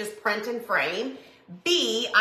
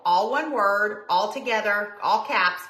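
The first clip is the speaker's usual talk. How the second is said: normal talking voice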